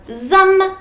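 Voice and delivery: plain reading voice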